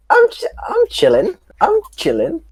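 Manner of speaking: very high-pitched mock protest; squeaky insistence. Player claiming to be fine when called hurt, squeaky insistence. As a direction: put on a tiny high voice, insisting.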